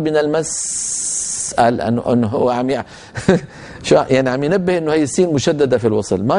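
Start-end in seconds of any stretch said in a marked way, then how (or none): 0.0-1.5 من المس trailing into an exaggerated sssss; wrong stretched seen. Shown as a demonstration. Teacher shows what is invalid in المس, stretched seen. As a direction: hold the s at the end of المس in a long fading hiss. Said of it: "ما يصح نقول".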